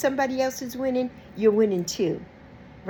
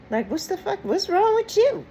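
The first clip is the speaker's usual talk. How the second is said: incredulous outburst; 10 semitones higher than usual. Acted. Outraged at fake friend.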